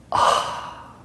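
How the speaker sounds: short breathy scoffing ah; disgust noise. Shown as a demonstration. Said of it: "So it's sassy".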